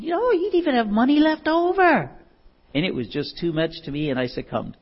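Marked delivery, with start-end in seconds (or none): 0.0-2.1 high put-on voice of a schoolmate; tempting friend. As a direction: coax in a pitched-up, sing-out voice. Friend tempting him, acted.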